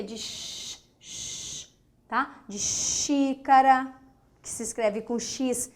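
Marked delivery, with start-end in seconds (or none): 0.2-1.7 "sh" as a bare, soft voiceless hush; sound of SH. Shown as a demonstration. Teacher answering which sound it is, soft SH hush. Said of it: "É o som de quê?". 2.6-3.9 "xícara" slowly, with a long hushed sh at the start; SH sound. Shown as a demonstration. Teacher giving an X example, drawn-out SH. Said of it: "mas tem o som de sh".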